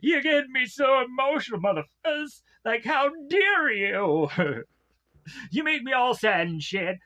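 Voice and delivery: high, hammed-up teary outburst; mock emotional gush. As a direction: overdo being moved, jokingly. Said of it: "I'm like".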